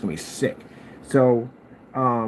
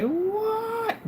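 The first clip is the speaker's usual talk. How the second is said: squeaky, much higher disbelieving what; shocked what. Retold or imitated. Hearing the man proudly call himself hillbilly, squeaked shock. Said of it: "I was like".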